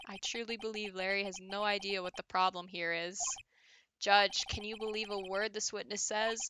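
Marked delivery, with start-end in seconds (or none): none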